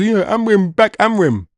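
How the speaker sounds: high mock chant of a fan cheering Amrim. Fan mocking the pushed hype: high mock cheering. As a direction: parrot the fans cheering Amrim. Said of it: "And we all have to do".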